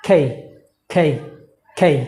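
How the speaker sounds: খেই with a puffed k and gliding vowel; correct pronunciation. Shown as a demonstration. Teacher modelling the correct letter name, aspirated k. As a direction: breathe out on k, glide through খেই. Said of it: "আমরা বলবো".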